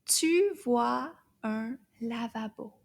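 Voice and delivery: tu vois un lavabo slow and linked; slow and smooth. Shown as a demonstration. Teacher reading the whole sentence slowly. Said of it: "Toute la phrase".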